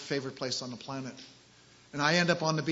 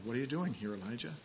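soft, hushed and gentle, fading to near silence; gentle quiet voice of God; still small voice; 4 semitones lower, 7 dB quieter, faster than usual. Acted. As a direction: ask it barely above a breath. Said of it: "Still small voice".

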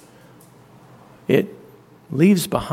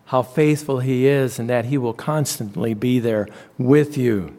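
ordinary delivery

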